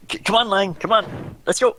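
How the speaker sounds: high, loud urging call; calling a dog. Acted. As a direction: coax the dog to hurry after you. Player calls the dog along.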